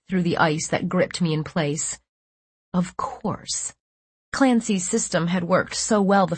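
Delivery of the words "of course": breathy stunned whisper. A narrator's reading. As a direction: whisper it to yourself. Repeated inner realization, whispered.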